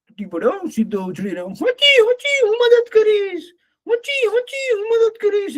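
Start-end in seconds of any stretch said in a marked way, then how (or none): 1.4-5.6 very high, eager donkey hee-haw; braying donkey. Acted. Donkey jumps up to help lapwing, braying. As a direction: squeal out the bray, leaping to help.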